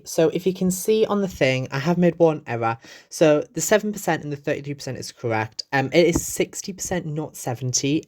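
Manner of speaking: chirpy narration over a time lapse; cheerful vlog voiceover. Acted. Voiceover recorded while editing.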